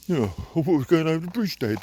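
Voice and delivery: put-on voice for the dog answering back; dog's voice. Owner voices his dog wanting the railway bridge, put-on dog voice. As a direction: speak as the disappointed dog.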